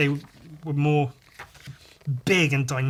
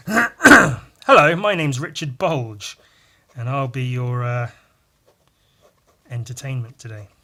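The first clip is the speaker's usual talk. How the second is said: announcer voice; 3 semitones lower, 9 dB louder than usual. Pretend. Introducing a character Richard Bulge.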